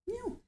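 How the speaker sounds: high, soft imitated meows; soft meow at cat. Host meowing to lure her cat, soft and high.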